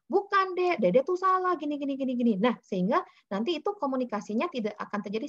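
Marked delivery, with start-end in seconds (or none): 0.1-2.3 put-on high, interrupting correction of a child; a parent cutting the child off; impatient parent. Shown as a demonstration. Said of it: "Jadi jangan baru".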